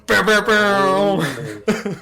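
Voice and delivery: high, slow, laughing bow bow bow; sung bow bow bow. Acted. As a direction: stretch it out like a fanfare. Mimicking a sound, laughing.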